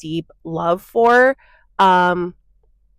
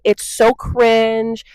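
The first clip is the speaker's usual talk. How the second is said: slow, drawn-out sneering mock voice; mocking quote; 3 semitones higher, much slower than usual. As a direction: mimic the snobs slowly and with disdain. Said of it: "I'm so over people being like".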